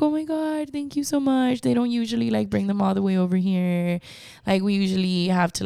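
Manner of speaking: put-on grateful old lady. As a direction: gush thanks like a frail grandma. Grateful old ladies voiced.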